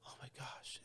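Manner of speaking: imitated hushed gasp of shock; shocked whisper. Locals react to his brash joke, shocked whisper. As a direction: whisper it, as if scandalised.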